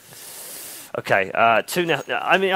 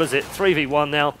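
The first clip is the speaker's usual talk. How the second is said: normal talking voice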